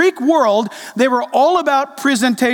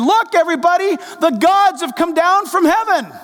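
higher-pitched retold amazement; crowd's proclamation; 6 semitones higher than usual. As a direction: announce it to everyone, raised in pitch. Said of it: "And they said".

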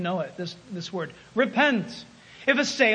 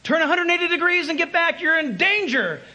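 urgent radio warning; 6 semitones higher than usual. Acted. Control tower radioing the captain to turn back, urgent. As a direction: warn the pilot over the radio, urgent. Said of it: "tower would radio to the captain".

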